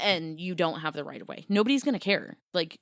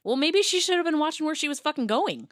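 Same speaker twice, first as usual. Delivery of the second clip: indignant outburst; 10 semitones higher, 7 dB louder than usual. Real, not acted. Indignant reply to complaining customer.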